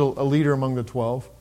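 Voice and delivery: ordinary talk, nothing marked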